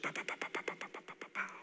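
voiceless rapid popping puffs; quick little mouth-made punches; imitated blows. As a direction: puff out a string of soft pows. Imitates the Spirit's repeated blows.

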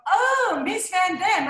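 high, loud delighted gasp of recognition; excited recognition. Retold or imitated. Customs officer at the desk greeting her, high and thrilled. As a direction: play the starstruck customs officer spotting a famous name.

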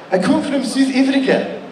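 high, put-on South African accent. Kid from South Africa answering, in a South African accent. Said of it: "he's like".

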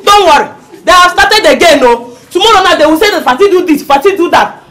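loud, quick, high-energy complaint; aggrieved rant. Acted. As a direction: rail against troublemakers while comforting. Mother rants about neighbours while consoling.

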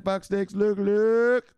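very high mock squawk; imitating her. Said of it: "That's how she said it".

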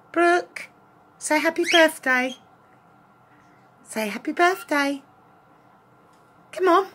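excited pleading with the dog; coaxing. Real, not acted. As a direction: urge the dog to join in.

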